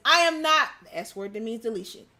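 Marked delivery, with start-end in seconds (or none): 0.1-0.7 loud, higher mock courtroom outburst; acted shout of protest; imitating a yell. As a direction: raise voice and pitch as if yelling at the court. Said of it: "Smollett yelled at the courtroom".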